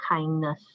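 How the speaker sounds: "kindness" modelled as "KIND-nuhs"; teacher's model of "kindness", stress on the first syllable; pronunciation demo. Shown as a demonstration. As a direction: show learners "kindness" with the vowel of "kind". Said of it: "so it's pronounced as".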